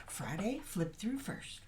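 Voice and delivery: wired, high and breathy; too much caffeine. Real, not acted. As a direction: rush it out, overcaffeinated. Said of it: "Sorry, I'm, I'm a bit wired".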